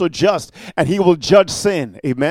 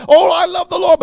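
mocking excuse; 10 semitones higher, 6 dB louder than usual.

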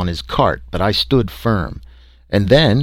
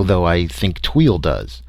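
usual speaking voice

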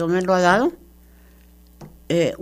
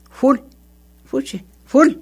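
louder, higher "fuchi" of disgust; 6 semitones higher, 5 dB louder than usual. Real, not acted. Preacher scorns churches full of false converts, disgusted. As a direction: spit it out like something rotten.

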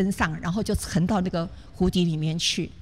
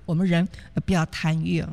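usual speaking voice

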